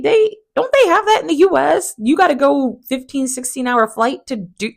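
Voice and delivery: high, loud disbelieving question; incredulous. Retold or imitated. To the cast, mocking the trip with high incredulity. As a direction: act baffled at the idea. Host mocks Todd's Dubai trip.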